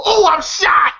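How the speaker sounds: high hammed-up yell of being hit; Ezell's mock panic cry. Narrator acts a character hearing a backfire, mock alarmed yell. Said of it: "Then you hear Ezell go".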